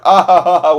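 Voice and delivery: loud, high canned laugh; mock a fake forced laugh; forced sitcom laugh. Reviewer mocking a forced Marvel-style joke laugh. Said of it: "those jokes where it's like".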